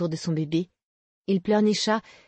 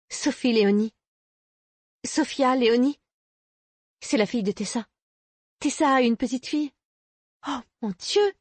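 higher, astonished exclamations in short bursts; sudden realisation; 6 semitones higher than usual. A narrator's reading. Young mother realising whose daughter is missing, astonished. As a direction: let it dawn, pitch climbing. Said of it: "s'exclama d'un seul coup".

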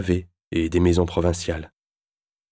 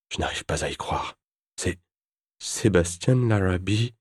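usual speaking voice

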